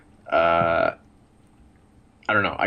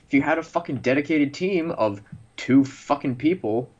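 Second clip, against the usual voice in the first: heated rant; 9 semitones higher than usual. Real, not acted. Ranting about Valve.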